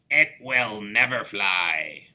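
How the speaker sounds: loud, slow, dismissive verdict; scornful expert. Acted. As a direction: boom it out like a know-it-all.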